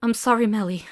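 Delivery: loud, distraught; anguished. Acted. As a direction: cry out an apology. Grief then checking companion.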